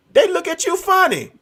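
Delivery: high-pitched indignant complaint; incredulous. Real, not acted. Man telling about holding doors, high incredulous complaint. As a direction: pitch it up in disbelief.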